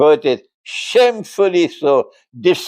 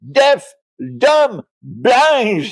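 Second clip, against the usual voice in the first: harsh language; 9 semitones higher, much slower than usual. Acted. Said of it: "This is harsh language".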